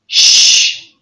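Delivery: "sh" hushed like quieting someone; a loud voiceless "sh"; shushing. Shown as a demonstration. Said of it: "you say".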